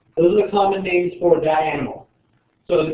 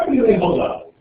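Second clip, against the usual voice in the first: higher mock-Latin flourish; put-on Latin accent; 5 semitones higher than usual. Shown as a demonstration. Teacher repeating a scientific name, Latin accent. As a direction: roll it out as playful Latin. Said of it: "That's my Latin sideways".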